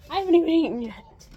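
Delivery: normal talking voice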